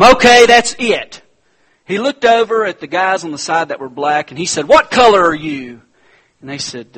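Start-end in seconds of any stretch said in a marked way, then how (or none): 0.0-1.2 loud, high, exasperated yell; a driver who has had enough; fed-up shout. Retold or imitated. Fed-up bus driver snaps. 4.7-5.8 loud, challenging question; stern demand. Retold or imitated. Driver demands of passengers.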